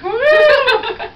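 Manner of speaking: high, loud, giddy whoop; Dopey whooping with diamonds on his eyes; laughing whoop. Retold or imitated. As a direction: whoop like a delighted fool.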